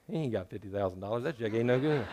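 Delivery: low, dismissive teller brushing off the check; bank teller scoffing. Acted. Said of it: "and they say".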